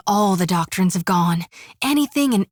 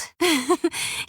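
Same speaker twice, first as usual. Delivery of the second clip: light laugh; 5 semitones higher, 4 dB quieter than usual. Real, not acted. Flirty banter with Watcher.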